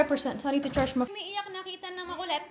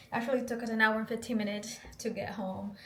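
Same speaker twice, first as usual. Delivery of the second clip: ordinary talk, nothing marked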